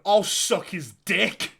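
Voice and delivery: retold thoughtless outburst, slow and loud; loud blurt. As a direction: call it out loudly without thinking. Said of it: "loudly just went".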